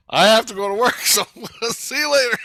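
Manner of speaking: loud, high words broken by real laughter; laughing. Real, not acted. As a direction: laugh your way through a goodbye. Laughs through his retold goodbye.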